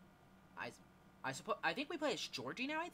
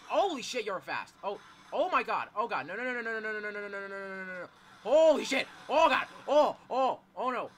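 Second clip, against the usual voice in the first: terrified horror victim; 4 semitones higher, 14 dB louder than usual. Real, not acted. Chased by monster in horror game.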